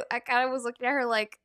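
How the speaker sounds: normal talking voice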